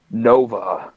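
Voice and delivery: low, drawn-out telepathic call; booming voice. Acted. Jedi calls out telepathically to a crewmate, booming. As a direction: let the name boom inside their head. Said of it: "you're gonna hear this booming voice".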